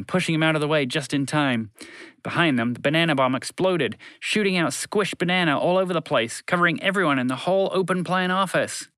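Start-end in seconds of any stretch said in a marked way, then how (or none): none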